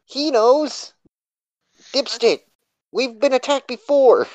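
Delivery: loud, high, urgent yell. Acted. Pointing at him and yelling to the guards, loud and high. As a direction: shout to get him to back you up. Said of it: "I point at him and yell".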